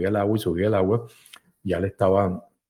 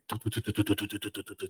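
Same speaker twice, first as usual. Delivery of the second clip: helicopter noise; 15 dB quieter than usual. Acted. Narrator imitates the helicopter arriving, very soft. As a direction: mimic a distant helicopter.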